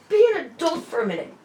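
high, loud exasperated order; angry demand. Retold or imitated. Scolding her boyfriend in the waiting room, loud and angry. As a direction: snap him into line.

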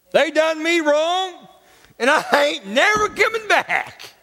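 hammed-up grievance in a high voice; mock aggrieved churchgoer. Mocking grudge-holding churchgoers.

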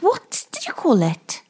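ordinary talk, nothing marked

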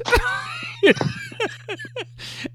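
high-pitched wheezy burst of laughter; laughing. Real, not acted. Storyteller breaks up over the friend's shocked reply, high laugh. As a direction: crack up at your own story.

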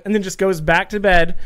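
ordinary delivery

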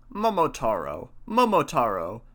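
mildly proclaimed name, falling then rising; lightly voiced old man naming the child; a touch of wonder, called out; old man crying out. A narrator's reading. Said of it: "cries the old man".

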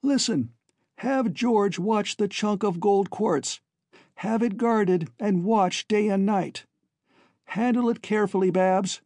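high, urgent voice from below; calling up. Acted. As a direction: call careful instructions up to your sister. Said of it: "Alan called up to Babs".

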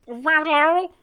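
high, trilled attempt at a creature name; imitating a small squawking creature; imitated kobold chitter. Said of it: "try and meet him where he's at".

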